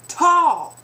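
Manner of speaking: mocking gawker; drawn-out tall. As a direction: blurt it out amazed. Mocking stare.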